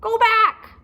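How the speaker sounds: very high, loud squawk; parrot voice. Acted. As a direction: screech it like a parrot. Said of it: "she squawked".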